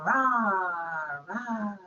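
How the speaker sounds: loud playful mock roar; lion roar. Shown as a demonstration. Lion puppet roaring at the children, loud mock roar. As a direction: roar like a storybook lion for kids. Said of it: "I want to make a roaring sound".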